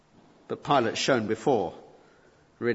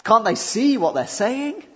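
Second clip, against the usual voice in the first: higher, louder indignant cry; outraged exclamation; 11 semitones higher, 7 dB louder than usual. Real, not acted. Preacher to his hearers, outraged loud question. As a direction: let the disbelief burst out.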